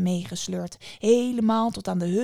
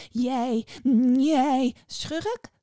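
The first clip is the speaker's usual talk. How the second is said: 1 furious witch; 6 semitones higher, 5 dB louder, much slower than usual. A narrator's reading. Witch sputters in fury.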